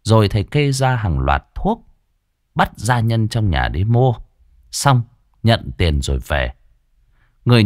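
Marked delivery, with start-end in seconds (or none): none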